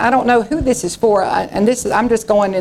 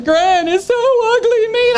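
upset daughter; 13 semitones higher than usual. Retold or imitated. Quoting daughter sobbing on phone.

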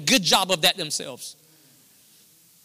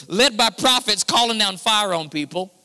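louder, pressing sermon delivery; forceful preaching; 4 semitones higher, 5 dB louder than usual. Real, not acted. Pastor hammering the church, loud and insistent. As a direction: drive the point home to the crowd.

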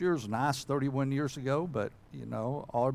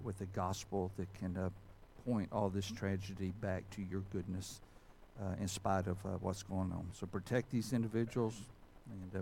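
lower-key, hushed public prayer; 4 semitones lower, 6 dB quieter than usual. Real, not acted. Pastor praying for earthquake victims, subdued prayer. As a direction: pray quietly and earnestly, phrase by phrase.

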